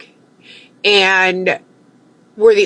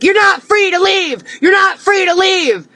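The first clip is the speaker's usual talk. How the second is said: cop shouting; 10 semitones higher than usual. Retold or imitated. Cop shouting at her through the car door. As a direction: bark it like a cop ordering her to stay. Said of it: "he's like".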